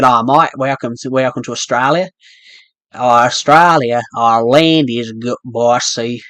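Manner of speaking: a broad Australian accent, slowing down at the end; welcoming someone to Australia in an Australian accent. Shown as a demonstration. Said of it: "I can do an Australian accent".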